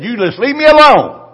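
wife scolding Smith Wigglesworth, loud and indignant. Retold or imitated. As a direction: snap it back at Smith. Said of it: "She said".

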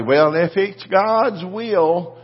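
slow, doubtful mock voice; mocking timid prayer. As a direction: mimic a hesitant believer. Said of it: "get in somebody".

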